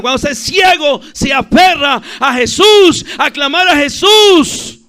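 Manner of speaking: high, loud pulpit cry; full-force preaching. Real, not acted. Preacher urging youths to call others to Christ, full-force preaching.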